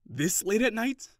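high, loud and slow, disbelieving; can't believe what he hears; shocked. Acted. Shocked at friend's news.

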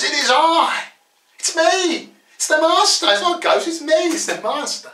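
high, urgent call to identify yourself; calling out reassurance. Acted. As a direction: call out high so they recognise you. Jesus calling to frightened disciples.

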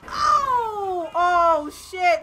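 loud, high cry running into shocked shouts; big real outburst; startled yelling. Real, not acted. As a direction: holler in alarm. Startled by crash in game.

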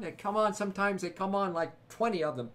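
usual speaking voice

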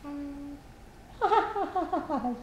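happy laugh. Acted. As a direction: laugh it out happily. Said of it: "she said happily".

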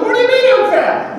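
high indignant question; mock insulted. Hearing listener pretending to be offended at being called fat. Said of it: "you get insulted".

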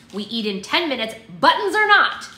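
exasperated mother yelling an ultimatum; scolding shout. A narrator's reading. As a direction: holler the last words at the kids. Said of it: "she scolded".

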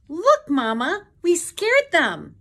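high, loud little-one squeal; excited child's squeal. A narrator's reading. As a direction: squeal proudly to Mama. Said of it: "the smallest shape squealed".

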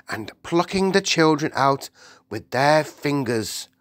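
agitated Queen. Acted. The Queen tells her maid about an awful dream, agitated.

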